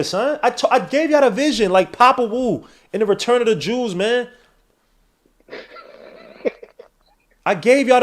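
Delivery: high, loud, worked-up ranting; hyped-up boasting rant. Real, not acted. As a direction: crow it like you called it all along. Hyped boasting after the basket.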